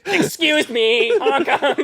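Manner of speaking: high, slow goofy voice; silly clown. Pretend. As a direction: ham it up like a clown. Silly truck driver bit.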